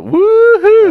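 high loud hillbilly whoop; twangy woo-hoo. Pretend. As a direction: holler it like a country yeehaw. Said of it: "he's got a little twang".

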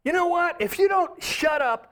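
retold on-stage outburst, pitched high; angry yell at crowd. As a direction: tell the crowd off, furious. Comedian yells at hostile crowd.

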